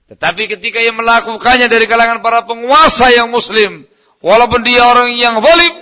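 high, loud, impassioned sermon; full-force preaching. Real, not acted. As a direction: thunder it out to the congregation. (berkhotbah lantang berapi-api)

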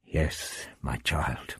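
low, soft, solemn reply; grave. A narrator's reading. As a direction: answer gravely, voice dropped and hushed. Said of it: "the doctor answered gravely".

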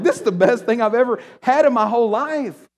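loud, high rave about the taste; over-the-top food ecstasy; excited rave. Retold or imitated. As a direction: gush like it's the best food ever. Raving about dessert.

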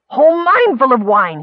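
high, loud, eager outpouring; excited gabble. A narrator's reading. Miner telling of the wine find, gabbling. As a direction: babble out the amazing news. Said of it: "he gabbled".

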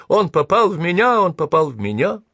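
high-pitched, panicky cry of a hit man; acted wail. A narrator's reading. Stormtrooper falls to the ground hit by a bullet, acted high wail. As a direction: cry out high, as if just shot. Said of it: "упал на землю и завопил".

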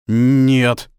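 loud, altered-voice refusal; stricken denial. Acted. Father hears of son's death.

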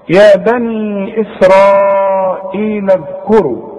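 slow chanted tajweed recitation; Quran recitation. Shown as a demonstration. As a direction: recite it slowly, vowels drawn out. Recites verse.

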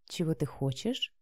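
very soft low coo. A narrator's reading. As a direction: purr the question sweetly. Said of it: "проворковала Вика". (проворковать нежно)

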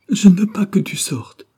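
high, loud theatrical outcry; desperate cry. A narrator's reading. Wife barring her husband's way, crying out in despair. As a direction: cry out in feigned sudden despair. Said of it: "cria-t-elle, en feignant un subit désespoir".

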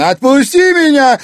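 very high, loud scream; shrill yell. A narrator's reading. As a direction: scream while wrenching free. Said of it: "вырвалась из его хватки Анфиса".

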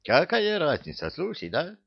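put-on high-pitched comic outrage, accented; indignant. A narrator's reading. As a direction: protest hotly as Givi. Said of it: "возмутился Гиви". (возмущённо, высоким голосом с акцентом)

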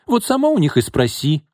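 high, testy retort; snappish. A narrator's reading. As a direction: throw the question back at her. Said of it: "огрызнулась Лина".

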